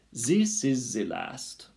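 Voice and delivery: put-on slow, loud, high French-accented declaration; French proclamation. A narrator's reading. Antoinette from her bed, proclaiming in French accent. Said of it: "proclaimed Antoinette from her bed".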